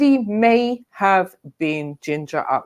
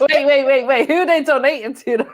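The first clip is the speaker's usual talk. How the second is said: laughing; 6 semitones higher than usual. Real, not acted. Reacting to the tease.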